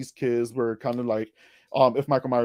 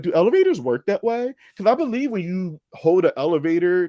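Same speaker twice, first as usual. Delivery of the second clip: incredulous; 6 semitones higher, 5 dB louder than usual. Retold or imitated. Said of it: "and I'm like".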